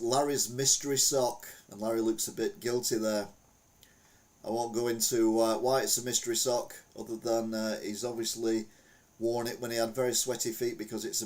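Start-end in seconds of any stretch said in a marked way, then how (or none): none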